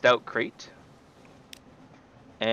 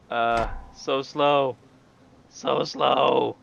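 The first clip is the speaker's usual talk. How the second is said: frustrated groan; 3 semitones higher, 5 dB louder, much slower than usual. Real, not acted. Frustrated at slow ship.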